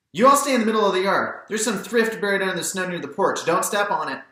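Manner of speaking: put-on old lady telling children to keep off her plants; old neighbour lady. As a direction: call out a warning across the yard. Maudie voiced, calling warnings.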